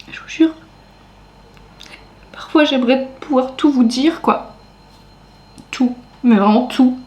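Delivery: trying not to cry; holding back tears. Real, not acted. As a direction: voice tight, fighting back tears. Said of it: "je me retiens de pleurer".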